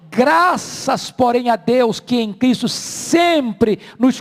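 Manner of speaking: loud, rising pulpit delivery, slowing to stress key words; full-voiced sermon climax; fervent preaching. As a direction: preach it out to the congregation.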